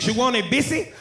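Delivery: put-on heavy Spanish-accented cashier. Popeyes cashier repeating the order, mock Spanish accent. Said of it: "He said".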